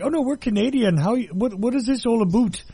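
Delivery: put-on rough go at a Canadian accent; attempted Canadian accent. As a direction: imitate cartoon Americans faking a Canadian accent. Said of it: "I can't do that accent".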